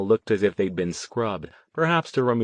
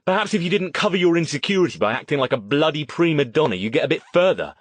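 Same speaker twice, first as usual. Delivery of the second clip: higher, fast, cutting rebuke; snapped; 7 semitones higher, 4 dB louder, faster than usual. A narrator's reading. Stone losing patience with Riley, snapped. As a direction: lash out in a rapid scolding. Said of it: "he snapped".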